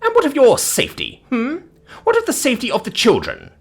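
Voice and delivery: high-pitched scornful challenge; haughty, indignant questioning; scoffing. Acted. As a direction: scoff like an affronted matron. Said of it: "she scoffed".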